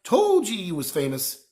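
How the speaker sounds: lightly voiced gruff gamekeeper, cheerful; a hint of big friendly Hagrid. Hagrid boasting to Harry, lightly voiced.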